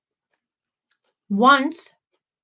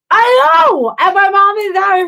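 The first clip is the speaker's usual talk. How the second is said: shout; 9 semitones higher, 7 dB louder than usual. Real, not acted. Excited agreement with chat.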